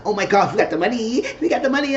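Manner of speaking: put-on high, excited girlish squeal; happy squeal. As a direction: gush like she just got rich. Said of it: "she was so happy".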